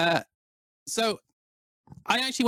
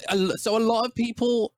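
usual speaking voice

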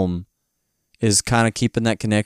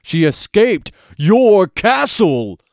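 higher, louder, slow taunt; dark lord taunt; 9 semitones higher, 6 dB louder, much slower than usual. Retold or imitated. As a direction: sneer at her failure. Gnome King mocking Mombi.

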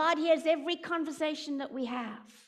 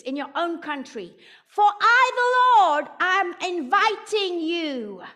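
voice of the Lord; 3 semitones higher, 9 dB louder than usual. Retold or imitated. The Lord speaking to her.

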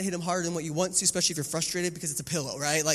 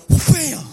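higher, louder wham; mock impact shout; 10 semitones higher, 14 dB louder than usual. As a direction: shout the hit like a sound effect. Dad swings pillow hard.

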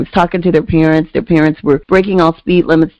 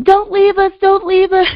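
mock kids pleading. Kids begging her not to leave, high mock pleading. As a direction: whimper it high like a scared child. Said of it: "they started screaming".

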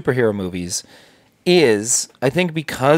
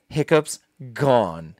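ordinary talk, nothing marked

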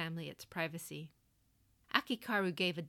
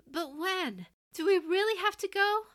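much higher, louder, anxious pleading; alarmed pleading; 13 semitones higher, 10 dB louder than usual. Acted. Son begging his mother not to leave, high and loud. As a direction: beg her, voice climbing in dismay. Son protests leaving home.

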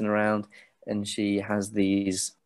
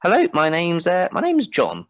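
higher, lightly voiced young man; the boy from the crowd answers, higher than usual; nervous boy; 8 semitones higher, 4 dB louder than usual. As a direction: answer her a bit too eagerly. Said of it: "And he goes".

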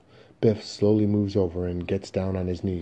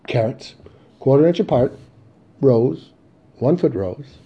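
loud, higher-pitched item-by-item reading; reading off instructions; 6 semitones higher, 9 dB louder than usual. Acted. Father reading seed packets by flashlight, loud listing. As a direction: call out each seed instruction separately. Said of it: "reading off the instructions".

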